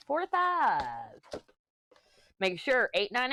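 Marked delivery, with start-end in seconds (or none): 0.1-1.2 high, slow drawn-out number; playful drawn-out reading. Acted. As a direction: stretch the word playfully.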